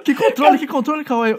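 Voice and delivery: a woken mother asks what he means, baffled; confused mother. Retold or imitated. Mother woken at night asks her son, baffled and high.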